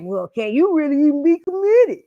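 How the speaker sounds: high, far, doubting man's voice; mocking suspicious man. New man doubting her commitment, high mocking voice. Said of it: "they'll be like".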